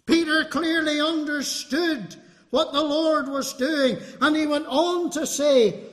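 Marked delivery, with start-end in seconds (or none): none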